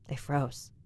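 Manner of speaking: breathless whisper of fear; frightened whisper. A narrator's reading. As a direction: whisper it, frozen in terror. Sees silhouette at night.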